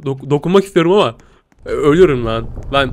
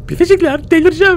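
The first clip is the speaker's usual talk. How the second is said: much higher exasperated wail; exasperated squeal; 12 semitones higher than usual. Real, not acted. Gamer telling his viewers he'll go mad, high wail. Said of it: "Vallahi delireceğim".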